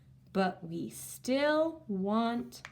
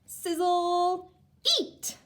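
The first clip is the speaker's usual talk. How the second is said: sizzle called out high and loud; excited; 9 semitones higher, 6 dB louder, slower than usual. Shown as a demonstration. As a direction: make sizzle pop with excitement. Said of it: "so we'll say 'em like we're excited".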